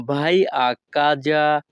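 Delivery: vai a casa said slowly, casa with a clear ka sound; teacher sounding out vai a casa; slow Italian model phrase. Shown as a demonstration. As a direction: pronounce vai a casa slowly and cleanly for learners. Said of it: "Italian ভাষা".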